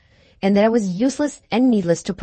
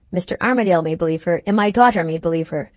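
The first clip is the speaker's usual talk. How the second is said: ordinary delivery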